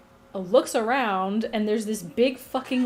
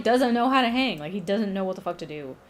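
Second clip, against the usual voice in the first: ordinary delivery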